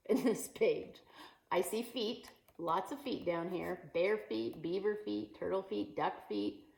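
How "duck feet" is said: usual speaking voice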